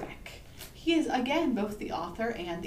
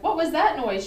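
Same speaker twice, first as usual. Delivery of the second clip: high, loud storybook alarm; startled question; 7 semitones higher, 9 dB louder than usual. A narrator's reading. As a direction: ask it like a spooked goose. Startled goose after the screech.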